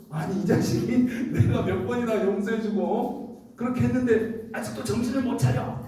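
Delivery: high-pitched exasperated telling-off with a laugh; mock scolding. Imagined Jesus scolding Peter, exasperated and high. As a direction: play-act an indignant dressing-down. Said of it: "하면서 이렇게 혼날까".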